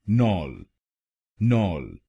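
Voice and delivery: नॉल with an open ɔ, nɔl; second syllable alone. Shown as a demonstration. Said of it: "आप इसको बोलिए".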